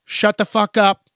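put-on firm parent shutting a kid down; stern parent. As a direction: snap it at the kid loud. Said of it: "what you need to do as a parent is say".

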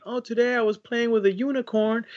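high, sweet imitated child; kid voice. Parent quoting a young kid's story, high and sweet. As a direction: lightly play the little kid sharing a story.

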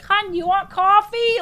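mock housewife voice, lilting up high; kindly lady. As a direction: put on a motherly lady offering coffee. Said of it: "the lady coming in and being like".

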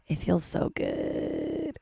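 high, slow breathy sigh; breathy whisper. Real, not acted. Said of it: "rowdy audience still outside".

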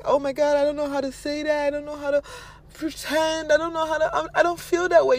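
high, loud, repetitive fussing; mock fretting. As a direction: imitate someone flustered and doubting themselves. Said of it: "So don't be thinking like it".

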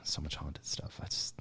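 breathy mock-weary whisper. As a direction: sigh it out, overwhelmed. Mock despair over haunted stuff.

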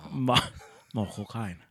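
Michael Caine, Cockney voice; better Michael Caine impression. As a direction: try Caine again, a little better. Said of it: "That's better".

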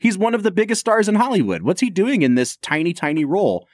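retold bafflement. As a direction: act out a baffled moviegoer's reaction. Retold bafflement at star's small part.

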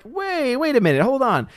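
high-pitched halting objection; mock protest. Streamer voicing legal immigrants' objection to easy citizenship, mock protest.

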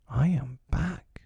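hotly. A narrator's reading. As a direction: say it slowly with a touch of heat. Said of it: "he said hotly".

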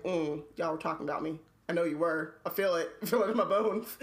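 low, knowing suspicion; self-mocking low suspicious voice. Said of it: "and I'm like".

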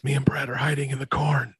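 secretive stage whisper; retold whisper. Hider tells Steve where they are, whispering. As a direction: whisper it into the phone. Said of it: "I'm gonna whisper to him".

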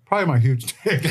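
blurting it out through a laugh. Real, not acted. Joke answer, laughs while saying.

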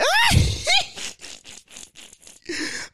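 big high cackling laugh; a real high-pitched laugh bursting out; loud burst of laughter. Real, not acted. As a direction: crack up loud at your own idea. Laughing at his own idea.

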